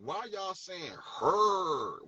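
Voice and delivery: "her" stretched long with a heavy r; drawn-out HER. Retold or imitated. Questioning the rappers' slang, "her" drawn out as "hurr". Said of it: "We don't say her".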